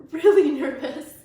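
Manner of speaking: laughing. Real, not acted. Narrator reading the story cracks up, laughing.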